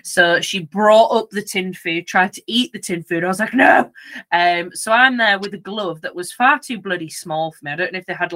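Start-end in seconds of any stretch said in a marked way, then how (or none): 3.5-3.9 loud, higher command to the dog; sharp no. Retold or imitated. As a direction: snap a firm no at the dog. Stopping dog eating vomit.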